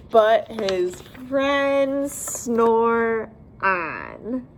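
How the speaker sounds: drawn-out closing words; slow bedtime close; very slow ending. Acted. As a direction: stretch it out sleepily.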